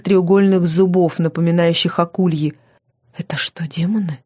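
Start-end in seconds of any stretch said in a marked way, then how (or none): 3.2-4.2 whispered question. A narrator's reading. Heroine asks her captor about the trophies, whispering. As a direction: drop to a whisper to ask. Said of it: "спросила почему-то шёпотом".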